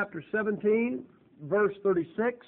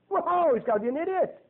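high-pitched mock verdict; exaggerated, high exclamation of judgement; mock outburst; 8 semitones higher, faster than usual. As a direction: imitate someone jumping to a scornful conclusion. Mock objection to the host's failure.

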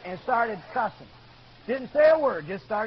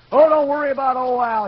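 loud dismissal; 4 semitones higher, 10 dB louder than usual. Retold or imitated. Coworkers writing Al off, loudly dismissive. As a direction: wave it off in a big scoffing voice.

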